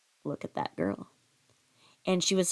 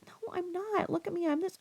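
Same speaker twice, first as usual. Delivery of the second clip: higher, softer flustered protest; blushing denial; 13 semitones higher, 6 dB quieter than usual. Retold or imitated. Girl told she is gorgeous protests, blushing and flustered. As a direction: brush it off, embarrassed.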